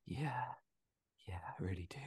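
barely voiced acted whisper; whispered confession. Struggling moviegoer admitting they think about death, whispered. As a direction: whisper it as a hushed admission.

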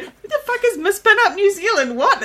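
high-pitched imitation of baffled onlookers; mock confusion. Kmart shoppers wondering about the stranger's title, mock bewilderment. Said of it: "all looking so confused".

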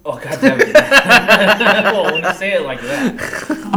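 loud, high laughing protest; talking through a big laugh; cracking up. Real, not acted. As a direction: give in to real laughter. Cracks up at friend's joke.

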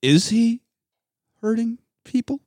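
high, halting question rising on "people"; questions-only voice. Retold or imitated. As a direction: pause before "hurting people", then lift it high. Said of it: "he's gotta talk to her in questions only".

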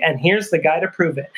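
normal talking voice

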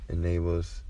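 ordinary delivery

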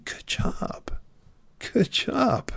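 hushed, tender "Good job" falling away; soft praise. Pretend. Speaker voicing a mentor to the listener, tender hushed praise. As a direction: breathe the praise gently like a proud mentor.

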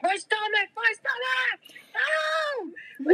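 high, wailing fake stomach pain; mock screaming sob. Friend's fake meltdown for the Muni police, a shrill mock wail. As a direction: wail like you're faking agony. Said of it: "violently screaming and pretending to sob".

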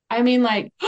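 ordinary talk

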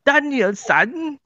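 high caricatured Japanese accent; mock Japanese accent. Shown as a demonstration. Quizzing friends with another accent, high mock Japanese voice. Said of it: "that's Chinese trying to be Japanese".

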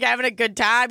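ordinary delivery

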